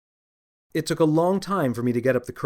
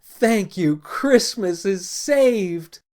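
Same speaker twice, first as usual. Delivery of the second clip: high, slow gushing delight; slow ecstatic thanks; overjoyed; 7 semitones higher, 4 dB louder, slower than usual. Acted. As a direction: gush with joy over the puppy. Overjoyed man hugs puppy.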